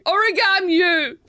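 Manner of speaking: slam poetry. Acted. Host apologising to Crocs in verse, slam poetry. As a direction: announce it like a dramatic slam poet. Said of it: "so it's in that style".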